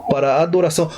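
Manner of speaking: ordinary talk, nothing marked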